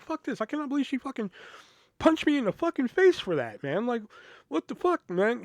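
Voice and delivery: high-pitched retold rant, incredulous; outraged disbelief. Venting to a friend about being punched, outraged. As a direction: replay an indignant outburst, voice jumping up high. Retelling own outraged outburst.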